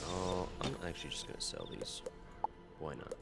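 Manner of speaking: ordinary talk, nothing marked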